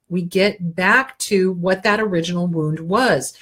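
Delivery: normal talking voice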